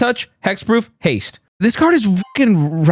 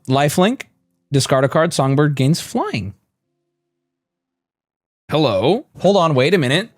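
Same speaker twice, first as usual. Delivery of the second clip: ordinary delivery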